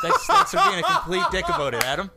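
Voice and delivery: high, loud laughing complaint. Real, not acted. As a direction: laugh while protesting. Laughing at teasing.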